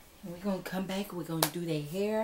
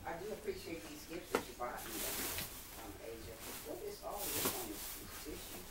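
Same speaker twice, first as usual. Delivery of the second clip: quiet fast aside; 5 semitones lower, 6 dB quieter, faster than usual. Acted.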